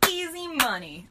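high, lively outburst; exclaimed. A narrator's reading. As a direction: pop it out like a gleeful pitch. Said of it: "I exclaimed".

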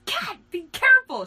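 high, loud warning shout. A narrator's reading. Driver warning a girl about the car door, shouting. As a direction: yell it in sudden alarm. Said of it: "shouted Riku".

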